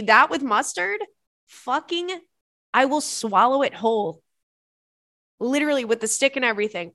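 excited. Real, not acted. Excited corn dog rave.

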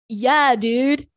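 mock surfer-dude accent, drawn out; hammed-up Californian surfer accent; surfer American accent. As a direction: imitate a foreigner's bad American surfer accent. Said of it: "or they do surfer".

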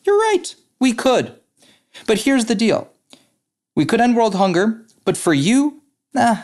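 the pig answers the chicken in a high cartoon voice; pig in joke. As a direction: pitch the pig's lines way up for the punchline. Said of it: "and the pig looks at the chicken and he says".

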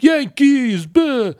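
loud, very high hammed-up New York accent; exaggerated New York accent. Yankees fan from Connecticut exaggerating a New York accent. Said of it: "they exaggerate that, like".

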